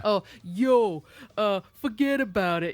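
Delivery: loud Jersey Italian caricature. Acted. Imitating a movie's Italian guy, hammed-up Italian accent. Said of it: "exactly like you would think of a stereotypical".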